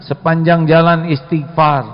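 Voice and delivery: normal talking voice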